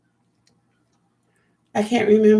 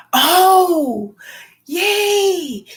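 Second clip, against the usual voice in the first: excited cheer; 9 semitones higher, 4 dB louder than usual. Real, not acted. Reacting happily to chat news.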